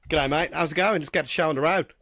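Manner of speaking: hammed-up Australian pretending to be Josh; fake Australian accent. Podcast host fakes Josh's Australian voice to guest, in a hammed-up Australian accent. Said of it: "Can you do an Australian accent?".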